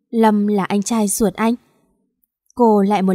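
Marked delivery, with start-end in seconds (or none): none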